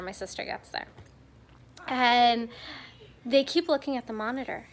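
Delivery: usual speaking voice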